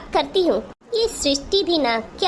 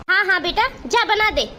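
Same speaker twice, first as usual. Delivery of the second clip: normal talking voice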